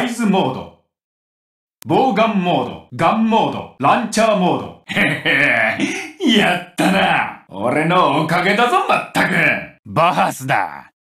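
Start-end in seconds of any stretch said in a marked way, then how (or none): 4.9-6.4 low, cocky little chuckle; pleased chuckle. Acted. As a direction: chuckle low after a win. Pleased chuckle before victory line. (得意げな低い含み笑い)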